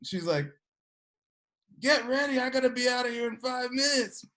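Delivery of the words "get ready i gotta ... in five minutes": imitated mom's high-pitched scolding; acted yell, raised in pitch; mock angry yelling. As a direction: order the kids along like a harried mother. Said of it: "yelling at the kids that morning".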